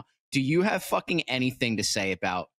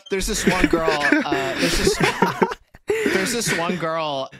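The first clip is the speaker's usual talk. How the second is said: laughing; 7 semitones higher, 5 dB louder than usual. Real, not acted. Laughing while starting a story.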